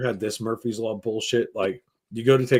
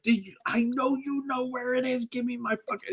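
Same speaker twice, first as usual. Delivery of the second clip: accusing mom; 12 semitones higher, 6 dB quieter than usual. Retold or imitated.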